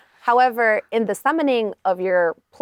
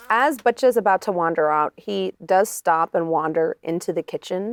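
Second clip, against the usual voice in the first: usual speaking voice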